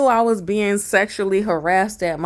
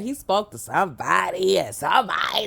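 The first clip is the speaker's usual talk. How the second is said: normal talking voice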